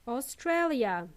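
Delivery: Australia slowly as "o-STRAY-lia"; Australia, careful and slow, falling at the end; English pronunciation. Shown as a demonstration. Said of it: "In English, it's".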